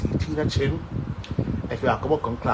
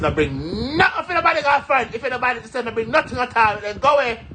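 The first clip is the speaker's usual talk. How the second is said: high, louder voice of a stern granny; granny warning; 11 semitones higher, 4 dB louder than usual. Retold or imitated. Granny warning her not to carry things, stern and high. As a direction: wag the finger like a strict grandmother. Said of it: "My granny says".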